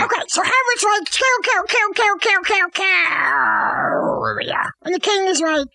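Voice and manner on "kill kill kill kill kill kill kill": hammed-up stabbing chant; mock killing frenzy. Hamlet finishes off the king, mock frenzy.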